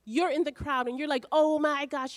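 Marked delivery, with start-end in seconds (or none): 1.3-2.2 higher, louder oh my gosh of awe; amazed gasp. Pretend. Crowd member seeing a miracle, louder and higher in amazement. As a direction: blurt it out like a stunned onlooker.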